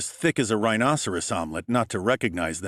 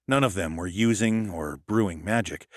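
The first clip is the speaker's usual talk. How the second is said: ordinary delivery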